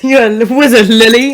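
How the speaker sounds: Harry Potter style British; mock British, rising on the name; British accent. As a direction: echo a British wizard-film line, laughing. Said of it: "turns into Harry Potter".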